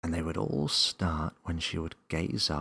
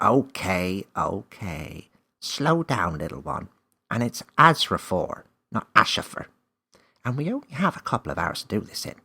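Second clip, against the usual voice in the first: louder elf, fast at the end; elf interrupting; 2 semitones higher, 8 dB louder than usual. A narrator's reading. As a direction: cut her off kindly. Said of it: "Name interrupted".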